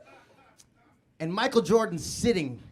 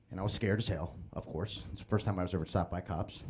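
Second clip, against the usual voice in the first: ordinary talk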